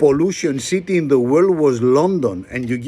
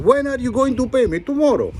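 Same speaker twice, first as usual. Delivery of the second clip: mock bank and Greek; 8 semitones higher than usual. Banks ask Greece.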